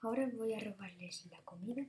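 sneaky whisper. Real, not acted. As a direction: hush it while sneaking. Sneaking to steal food.

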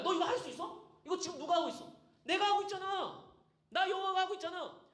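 high, emphatic challenge to Job; God. Acted. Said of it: "하나님이 욥한테 그러는 거야". (높은 음으로 몰아붙이듯 강조)

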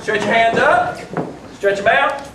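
loud, high fitness instructor calls; workout coach. Acted. Speaker leading the audience through stretches, loud coach calls. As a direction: lead the room like a trainer. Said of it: "Everybody stand up".

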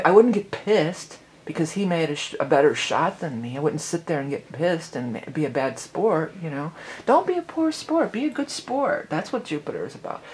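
ordinary talk, nothing marked

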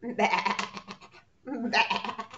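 loud laughing bleat; sheep baa. Shown as a demonstration. Teacher showing preschoolers the sheep sound, bleating. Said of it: "sound like this".

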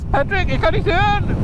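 high, squeaky cartoon sponge voice; SpongeBob voice. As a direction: play SpongeBob calling out.

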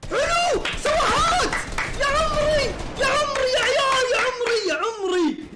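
very high, thrilled cheering; excited cheer. Real, not acted. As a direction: cheer the great shot at full pitch. Celebrating a kill. (هتاف فرح بصوت عالٍ جداً)

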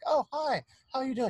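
high, chirpy hello; mock cheerful greeting. Teller imagining the sister greeting guests, mock chirpy and high. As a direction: pretend she's delighted to see them. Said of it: "She doesn't look like".

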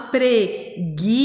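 PRE-GUI-ÇA in slow, separate beats; emphatic teacher's dictation; syllable by syllable. Shown as a demonstration. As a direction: sound it out with a gap after each syllable. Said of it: "E a próxima".

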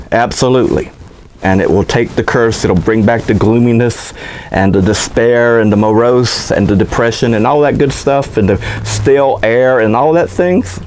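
normal talking voice

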